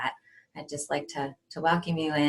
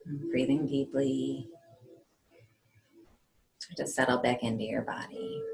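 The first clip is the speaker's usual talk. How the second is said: hushed, unhurried guide into calm; guided meditation; 3 semitones lower, 6 dB quieter than usual. Acted. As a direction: settle them gently, leaving space between phrases.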